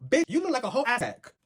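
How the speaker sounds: high, loud outraged clapback; loud, high exasperated roast of the commenter; outraged call-out. Real, not acted. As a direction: let her have it, scandalised. Clapping back at a commenter.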